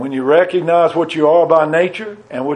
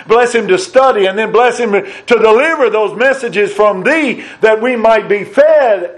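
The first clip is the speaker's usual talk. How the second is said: pleading prayer; 4 semitones higher than usual. Acted. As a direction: pray it out higher, stretching the pleas.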